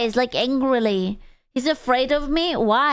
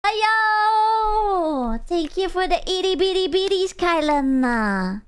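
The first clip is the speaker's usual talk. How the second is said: high, bright drawn-out hello; excited greeting; 6 semitones higher, slower than usual. Real, not acted. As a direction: greet the chatter with a high, sing-song hello. Greeting and thanking chatter.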